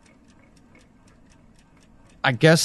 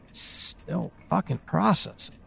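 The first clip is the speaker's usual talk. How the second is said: muttered to herself; 2 semitones higher, 9 dB quieter, slower than usual. Acted. Overwhelmed by the message, muttering.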